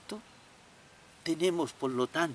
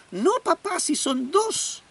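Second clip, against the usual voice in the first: put-on high, loud, slow little boy objecting; stubborn child; 11 semitones higher, 5 dB louder than usual. Boy contradicts his father, high and slow. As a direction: stubbornly correct his dad.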